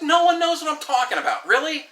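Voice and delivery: incredulous whine. Retold or imitated. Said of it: "I'm like".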